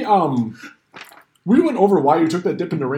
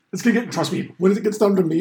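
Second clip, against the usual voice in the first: usual speaking voice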